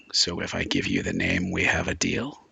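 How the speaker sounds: very low voice, rising into a question; cautious bargainer. Acted. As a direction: sound out the deal in a low voice. Magdalena cautiously bargains, voiced by game master.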